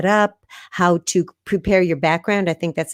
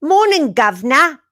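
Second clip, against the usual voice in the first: a high, hammed-up London "Morning, governor"; lightly put-on East End London greeting; mock London accent; 7 semitones higher, 4 dB louder than usual. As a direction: try a Cockney greeting, overplayed. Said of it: "Would it be like".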